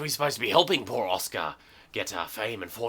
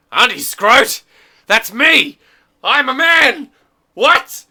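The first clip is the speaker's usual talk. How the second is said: high, loud indignant screech; outraged shriek; 13 semitones higher, 10 dB louder, slower than usual. Acted.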